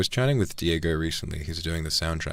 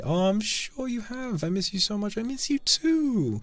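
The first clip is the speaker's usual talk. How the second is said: cutesy voice; 3 dB louder, slower than usual. Acted. Sweet cutesy reply to chat.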